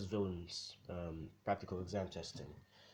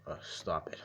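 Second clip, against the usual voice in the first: lower, bashful "Uh, stop it", played down; shy stop it; 5 semitones lower than usual. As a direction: mumble "stop it" lower, a flattered son brushing off praise. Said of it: "but he says it, like".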